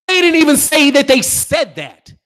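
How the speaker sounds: high, loud objection; indignant protest. Real, not acted. Host disputing a boxing report, indignant protest.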